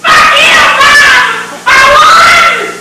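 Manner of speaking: triumphant yelling at everyone; defiant shouting. Acted. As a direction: fling curses at the whole world.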